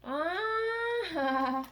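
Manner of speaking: high, loud, drawn-out "ah"; long excited squeal; delighted scream. Real, not acted. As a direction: cry out in delight. Delighted at parcel contents.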